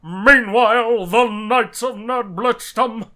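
loud, slow booming announcer; fighting game announcer. Pretend. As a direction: stretch it out like an arena announcer. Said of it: "takes over the announcer bit".

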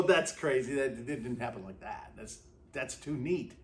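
a lightly played skeptic, brushing it off; skeptical scoff. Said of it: "we go".

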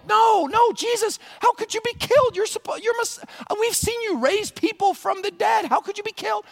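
very high, squealed disbelief; frantic falsetto protest; hammed-up panic. Disciples told Jesus will be killed, panicked and very high.

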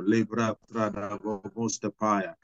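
slow prayer in tongues. Real, not acted. Preacher praying aloud in tongues, slowly. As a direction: let the tongues flow slowly.

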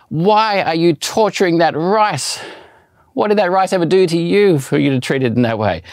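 hammed-up scandalized protest over boiled rice; Uncle Roger outrage. Girlfriend scolding him Uncle Roger style for boiling rice, mock outrage. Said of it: "reacted like Uncle Roger on YouTube".

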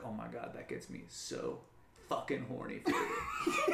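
breathy sultry moan; horny Brooke impression. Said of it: "her being like".

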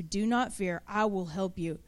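soft, low reassuring promise; God's comfort. Acted. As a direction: speak it gently as God to a frightened child. God speaking comforting words.

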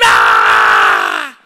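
low loud drawn-out crowd roar; mock crowd roar. Preacher imitating the crowd's cheer, a low loud roar. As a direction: roar like a whole gym.

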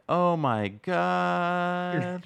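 drawn-out, slow and flat bad-actor line; mock the terrible acting with a slow, lifeless Oh my God; flat, boring delivery. Imitating the actor's flat, terrible reaction to the goblin. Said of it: "he just says it just like that, that kind of flat, boring".

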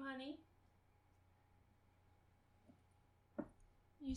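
high, soft cooing voice. Real, not acted. Talking sweetly to her cat.

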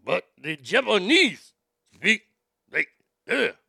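choppy mock Japanese delivery, syllables punched apart; authoritative Japanese inflection. Host admires how Japanese people speak, mock Japanese inflection. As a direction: imitate bouncy, authoritative Japanese speech. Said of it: "very kind of, uh, authoritative".

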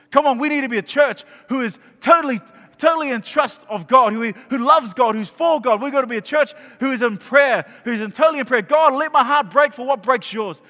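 high, loud, urgent church exhortation; impassioned preaching. Real, not acted. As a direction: preach it hard, pleading for a praying church. Preaches at full force.

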